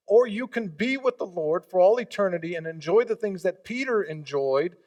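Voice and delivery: ordinary talk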